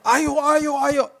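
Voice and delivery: high-pitched "come on" chant; egging on. Retold or imitated. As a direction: imitate a crowd urging someone on. Friends egging him on to dance.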